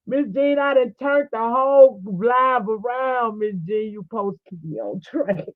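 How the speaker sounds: lightly voiced scolding of oneself; mock scolding Miss Jean. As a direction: scold yourself like an auntie. Scolding herself in a voice.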